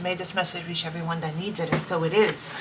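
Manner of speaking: hushed, softly spoken prayer. Acted. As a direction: murmur a prayer of thanks softly. Opening prayer to spirit guides.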